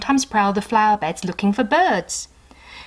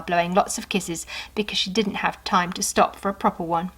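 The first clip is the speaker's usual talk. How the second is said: plain reading voice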